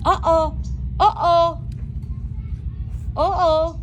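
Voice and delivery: mock uh-oh. Real, not acted. Cooing to dogs about crying.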